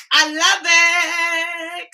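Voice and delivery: high, loud, very slow I love it; drawn-out delight. Real, not acted. Thrilled at viewers, drawn-out high. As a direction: stretch it out with delight.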